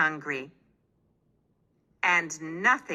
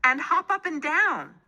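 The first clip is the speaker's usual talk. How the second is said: helpful baker; 10 semitones higher than usual. A narrator's reading. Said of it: "said Homer".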